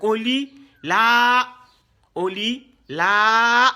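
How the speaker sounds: teacher drags the long vowel out so students hear it; drawn-out reading demo. Shown as a demonstration. Teacher showing students the lengthened vowel, slow and drawn out. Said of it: "on l'a sur le waw".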